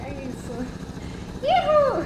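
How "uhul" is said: excited whoop. Real, not acted.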